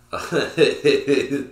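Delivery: loud high laugh; real laugh burst. Real, not acted. The vlogger cracking himself up, loud laughing burst.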